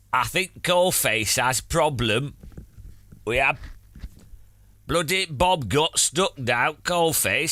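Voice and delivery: hammed-up Northern English miner; Northern English coal miner chatting to chat; Northern English accent. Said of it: "Northern Voice".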